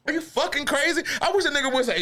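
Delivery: high-pitched mock outrage; incredulous retort. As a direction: push the pitch way up, indignant at the very idea. Acted retort to a kill order.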